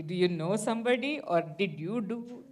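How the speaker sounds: normal talking voice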